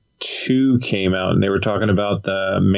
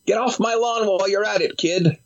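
put-on much higher cranky old man voice; crazy old coot; 11 semitones higher than usual. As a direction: yell it like a grumpy old-timer. Mock old man joke.

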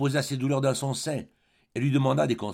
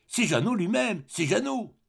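overjoyed exclamation; 9 semitones higher than usual. Acted. Overjoyed at finding friend.

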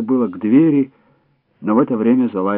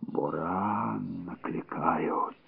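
slow, breathy, barely voiced whisper; old woman whispering; 5 semitones lower, 14 dB quieter, much slower than usual. A narrator's reading. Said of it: "прошептала старуха".

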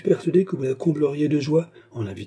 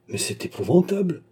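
horrified cry; 7 semitones higher than usual. A narrator's reading. Old baroness hearing bad news, crying out high in dismay. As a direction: pitch jumps higher on a shocked exclamation. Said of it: "s'écria madame de Kermadec".

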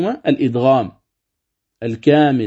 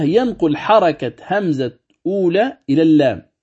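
ordinary delivery

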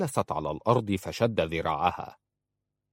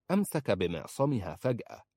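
ordinary delivery, nothing marked

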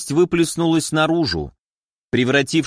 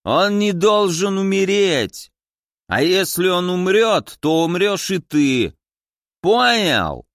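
put-on drunk threatening; 5 semitones higher, slower than usual. A narrator's reading. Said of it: "пьяно рявкнули с той стороны".

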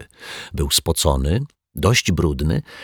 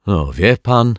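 slightly raised, affronted protest; higher, understated huff; offended; 4 semitones higher, 4 dB louder, slower than usual. A narrator's reading. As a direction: sound mildly hurt and indignant. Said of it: "chrząknął urażony pan Mietek".

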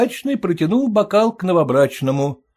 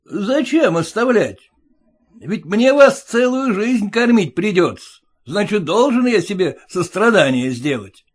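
high, louder, a snarling retort; snapping back; 7 semitones higher, 4 dB louder than usual. Acted. Groom snaps back at his pleading bride. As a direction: snap at the bride, higher. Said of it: "огрызнулся новобрачный".

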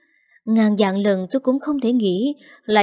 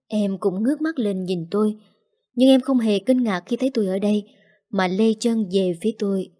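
ordinary delivery

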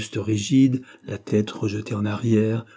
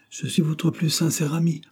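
pleading protest; 7 semitones higher than usual. A narrator's reading. Suitor assuring the hostile girl of friendship, pleading protest. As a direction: protest sincerity in a raised pitch.

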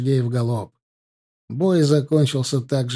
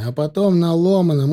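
usual speaking voice

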